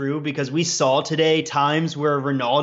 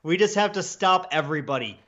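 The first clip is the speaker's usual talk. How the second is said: mock coach briefing, pitched higher; imitated team briefing; 5 semitones higher than usual. Coach to players in the meeting room, mock briefing. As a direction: lightly play someone revealing the game plan. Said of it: "imagine, like, someone, like, in the meeting room".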